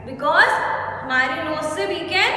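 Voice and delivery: ordinary talk, nothing marked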